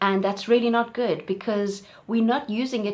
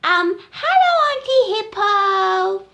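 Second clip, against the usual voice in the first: high, loud, slow timid greeting; little elephant voice; 12 semitones higher, 9 dB louder, slower than usual. Acted. Little elephant greeting Auntie Hippo, high and slow. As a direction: greet shyly like a small calf. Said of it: "And he said".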